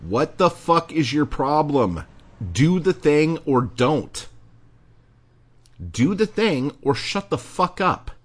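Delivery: sharp, loud, fed-up demand; exasperated rant. Real, not acted. Host loses patience with whining writers, loud and angry. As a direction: bark it at them like you've had enough.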